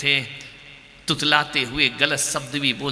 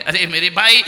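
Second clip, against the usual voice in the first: normal talking voice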